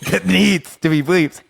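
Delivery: laughing it out again. Shown as a demonstration. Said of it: "we gotta bleep that out".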